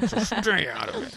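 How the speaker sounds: high, laughing through the line. Real, not acted. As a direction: laugh while you say it. Repeats it laughing.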